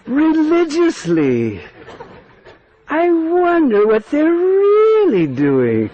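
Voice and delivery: high, slow drawn-out doubting voice; sarcastic disbelief. Acted. Kutila hearing about the bathing, sarcastic. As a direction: stretch it out, dripping with suspicion.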